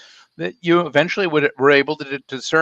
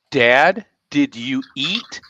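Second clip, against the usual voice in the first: slowed-down version; much slower than usual. Shown as a demonstration. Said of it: "that's how they talk".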